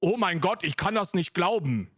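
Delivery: mock-angry, hard-edged German accent; harder German accent. Shown as a demonstration. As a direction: demonstrate a hard German exclamation. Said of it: "a much harder one".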